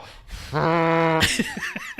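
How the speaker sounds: drawn-out animal call after the shriek; imitated peacock noise. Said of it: "they make that noise".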